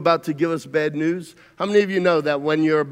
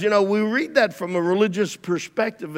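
usual speaking voice

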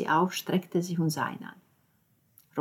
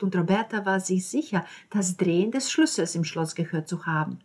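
ordinary delivery, nothing marked

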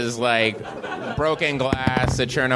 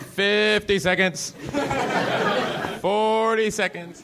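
high, slow countdown callout; 10 semitones higher, slower than usual. Retold or imitated. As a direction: drag out the countdown like a floor manager.

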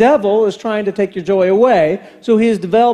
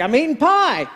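innocent dad; 7 semitones higher than usual. Retold or imitated. Dad caught, answers innocently.

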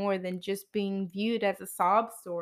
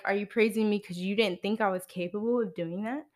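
ordinary delivery

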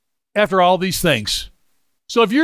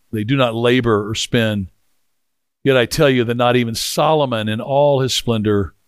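lower, measured Bible reading; scripture reading; 6 semitones lower than usual. Acted. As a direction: read the passage low and steady. Reads scripture passage aloud.

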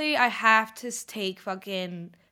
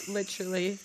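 normal talking voice

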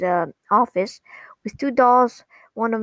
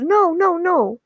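high-pitched retold protest; flustered protest; 8 semitones higher, slower than usual. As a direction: imitate a flustered, high-voiced denial. Stolas hastily corrects a misunderstanding.

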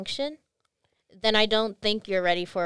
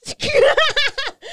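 high laugh; 9 dB louder than usual. Real, not acted. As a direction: burst of high-pitched laughter. Reacting to girlies joke.

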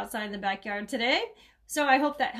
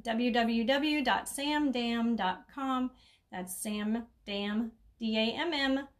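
slow dictation; much slower than usual. Shown as a demonstration. Host spelling her website for listeners, slow and careful. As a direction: spell the web address out slowly and clearly. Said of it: "Don't spell it like the swear word".

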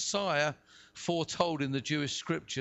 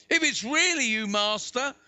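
raised, loud call across distance; 5 semitones higher, 5 dB louder than usual. A narrator's reading. Peter answering Jesus from the boat, calling out. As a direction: call out to the master over the waves.

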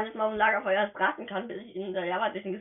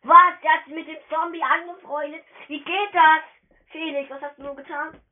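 loud, higher astonished questions; can't believe it; loud disbelief; 7 semitones higher, 8 dB louder than usual. Real, not acted. Gamer sees a befriended zombie, loud astonished questions.

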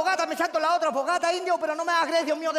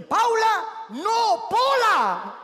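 high, louder, stretched names; hammed-up high call; dramatic name reveal; 6 semitones higher, 3 dB louder, much slower than usual. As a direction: proclaim the correction like a big reveal. Acted exchange building to reveal.

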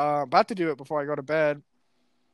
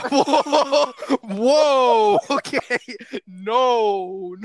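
loud, high whoa to halt someone; alarmed whoa; 6 semitones higher, 6 dB louder, much slower than usual. Real, not acted. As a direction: shout them down in mock alarm. Reacts to taboo topic suggestion.

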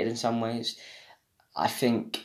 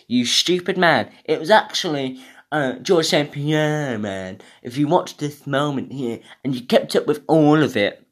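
higher, louder scolding viewer; mocking commenter; 4 semitones higher, 5 dB louder than usual. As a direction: scold like an annoyed fan in the comments. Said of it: "people to say in the comments".

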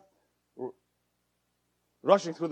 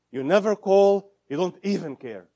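much louder accusing outburst; blaming complaint; 3 semitones higher, 7 dB louder than usual. Shown as a demonstration. Therapist acting a hurt spouse's accusation, loud. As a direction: hurl the complaint. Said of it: "It happens, right?".

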